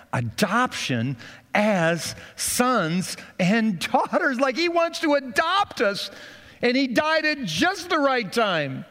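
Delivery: soaring, high altar-call plea; passionate preaching. Acted. Pastor inviting congregation to receive Christ, passionate preaching. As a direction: urge them to receive now.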